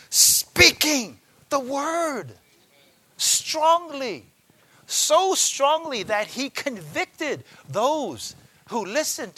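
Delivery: high, loud, slow emphasis; preaching with force. Real, not acted. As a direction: proclaim it strongly and slowly. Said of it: "preaching of Peter's boldness".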